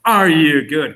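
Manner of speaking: ordinary talk, nothing marked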